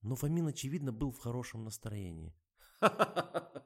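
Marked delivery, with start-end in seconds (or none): 2.8-3.6 loud high laugh. A narrator's reading. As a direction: laugh it out heartily. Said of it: "сказал он".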